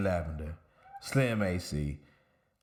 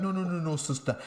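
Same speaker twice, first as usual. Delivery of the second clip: mock Jamaican reassurance; 8 semitones higher, faster than usual. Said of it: "And I'm like".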